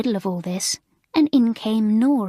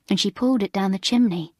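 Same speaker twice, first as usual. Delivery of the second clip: ordinary delivery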